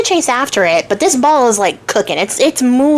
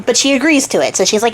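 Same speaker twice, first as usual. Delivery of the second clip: ordinary delivery, nothing marked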